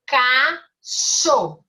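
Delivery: "Cachorro" very slow, split into separate syllables; "Cachorro" divided slowly for young pupils. Shown as a demonstration. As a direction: sound out "Cachorro" with clear gaps between syllables. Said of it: "Eu abro duas vezes a minha boca".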